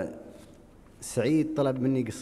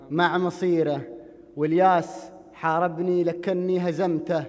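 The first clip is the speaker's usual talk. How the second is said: declaimed Gulf Arabic poem, measured and rhythmic; projected verse with drawn rhyme endings; poetry recitation; 7 semitones higher, 7 dB louder than usual. Acted. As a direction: recite each verse to the crowd, higher and firmer than chat. Recites his poem to audience.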